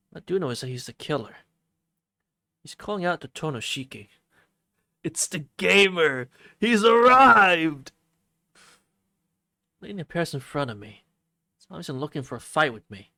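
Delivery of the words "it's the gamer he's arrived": loud, slow, drawn-out announcer call; hype announcer. Pretend. Streamer announces his own arrival in a loud, slow hype call. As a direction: boom it out like a ring announcer.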